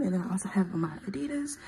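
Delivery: quick hushed whisper while listing shoes. Real, not acted. Showing off her outfit to viewers, in a quick whisper. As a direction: rattle it off in a fast whisper.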